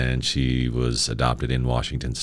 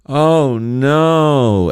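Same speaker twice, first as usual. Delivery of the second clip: higher and louder, a crowd's dismayed oh no; imitated audience groan; 10 semitones higher, 5 dB louder than usual. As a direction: voice the whole room's sinking reaction.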